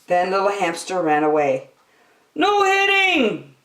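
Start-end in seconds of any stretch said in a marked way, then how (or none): 2.3-3.6 high, loud, drawn-out cry. A narrator's reading. Piggy calls after the fleeing hamster, high and loud. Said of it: "Piggy cried after him".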